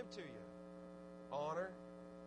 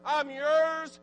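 1 fervent surrender; 7 semitones higher, 11 dB louder than usual. Acted. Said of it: "humble yourself and say".

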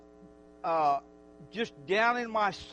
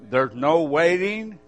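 loud, fervent preacher voice; preaching; 9 dB louder than usual. Acted. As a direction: preach it out to the whole church. Preaching to the congregation.

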